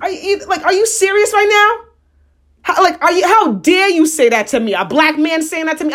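high-pitched outrage without full volume; retold indignant shouting. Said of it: "this is what I'm shouting".

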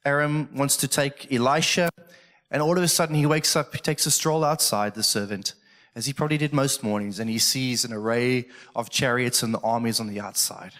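normal talking voice

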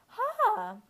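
real crack into a squeak. Real, not acted. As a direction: voice cracking high. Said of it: "did you hear my voice crack there".

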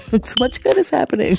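laughing exclaim. Real, not acted. Laughing about good fortune.

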